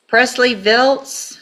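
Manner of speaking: loud, slow, drawn-out call across the room; calling out a name. Real, not acted. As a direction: call the name so the back row hears. Judge calling next defendant.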